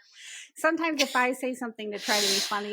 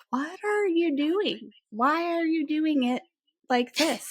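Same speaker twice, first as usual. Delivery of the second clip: exasperated parent; 6 semitones higher than usual. Retold or imitated. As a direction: question your child in stretched-out, pitched-up exasperation. Retold exasperation at oldest child.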